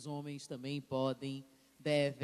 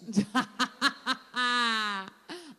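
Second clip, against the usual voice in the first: loud laugh; 6 semitones higher, 6 dB louder than usual. Real, not acted. Host laughs at joking age answer.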